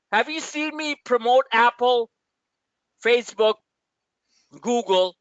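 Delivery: ordinary talk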